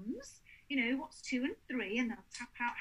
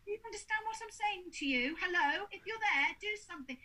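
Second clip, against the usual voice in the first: mock demanding ghost hunter, pitched up; talking as to grown-ups; 6 semitones higher, faster than usual. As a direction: imitate an investigator questioning a child bluntly. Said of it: "like they're a grown-up".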